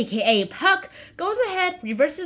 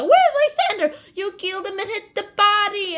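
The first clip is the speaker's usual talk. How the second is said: high-pitched hammed-up demand; mock accusation; 7 semitones higher, 4 dB louder than usual. Hermia demanding Lysander, mock accusation. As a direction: play Hermia confronting a suspect.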